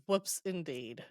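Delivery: dry, unimpressed retort. Retold or imitated. He answers her slip, very dryly. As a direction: echo it drily, lightly acted. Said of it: "very dryly".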